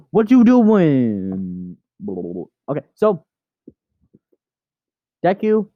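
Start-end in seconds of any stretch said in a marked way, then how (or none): 0.1-1.8 startled cry. Pretend. Owner catches the cat moving, mock startled. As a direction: gasp and wail the question in mock shock.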